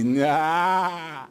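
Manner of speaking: lightly voiced wrestler character; Broken Matt Hardy voice. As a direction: toss off a quirky character nah. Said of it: "I'm gonna stop doing that".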